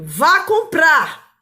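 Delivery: "Vá comprar" said as a firm, slow order; commanding order. Shown as a demonstration. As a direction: give the order firmly and slowly. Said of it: "Frase imperativa, apresenta um pedido ou uma ordem".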